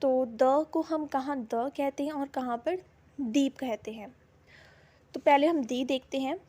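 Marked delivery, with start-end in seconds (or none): none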